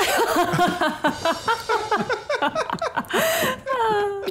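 high hearty laugh at her own joke; big laugh. Real, not acted. After telling the genie joke, hearty laugh. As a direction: burst out laughing.

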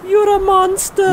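very high, loud falsetto; frightened girlfriend. Acted. Girlfriend fending off the monster, high upset cry.

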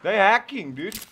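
high, loud urgent plea; urgent callout. Real, not acted. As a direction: call for smoke in a hurry. Urgent team callouts mid fight.

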